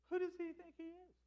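high-pitched mock indignation; indignant. Brothers resenting Joseph's dream, indignant. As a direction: pitch it way up, affronted.

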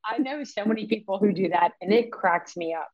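ordinary talk

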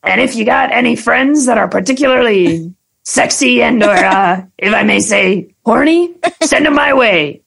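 flirt like a sleazy ride car. Pretend. Improvised sexy car voice bit.

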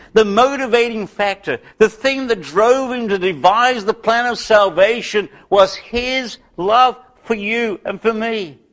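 high-pitched, insistent sermon delivery; impassioned preaching. Acted. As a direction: drive the point home to the congregation. Preaches at raised pitch and force.

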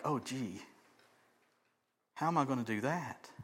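low, soft, deflated reply; daunted. Acted. Daunted listeners reacting to Jesus's demand, quietly deflated. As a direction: mutter it helplessly under your breath.

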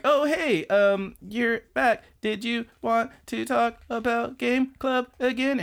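put-on high, halting, hopeful girl voice; Karen. Girl asking her crush about Game Club, high and awkward. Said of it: "And Karen's like".

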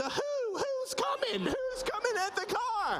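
put-on high, whimpering child voice; scared little kid. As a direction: whine it like a frightened child. Kid asks mom in panic.

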